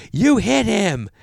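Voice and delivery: high, loud mock yell; imitated yelling accuser. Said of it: "She's yelling at me".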